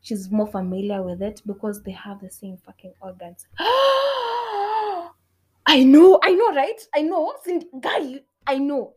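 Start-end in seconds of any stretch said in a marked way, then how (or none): 3.6-8.9 gasp, then high, loud excitement; mock-amazed excitement. Sharing a revelation about women's bodies, mock-amazed. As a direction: squeal it as if stunned by your own revelation. Gasps, delighted at own revelation.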